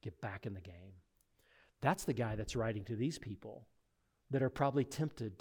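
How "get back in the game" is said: much softer, gentle encouragement; reassuring. Retold or imitated. Jesus restoring Peter, soft and gentle. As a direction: lift him up tenderly. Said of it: "and says".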